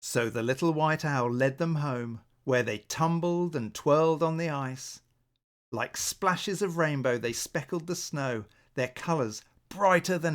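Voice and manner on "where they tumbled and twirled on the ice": ordinary delivery, nothing marked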